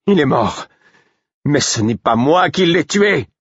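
shouting to be heard above the lamenting fool; desperate cry. A narrator's reading. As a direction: cry out over the fool's wailing. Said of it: "Mon cri".

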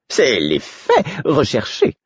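a lightly voiced smug jester; the fool. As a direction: savour it like a vain jester. Fool's smug reply.

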